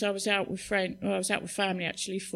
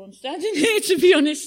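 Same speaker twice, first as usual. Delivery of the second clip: loud, high laugh through the words; 9 semitones higher, 12 dB louder than usual. Real, not acted. Speaker marvels at standing up talking at all, laughing. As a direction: break into a laugh, higher and louder.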